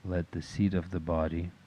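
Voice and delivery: usual speaking voice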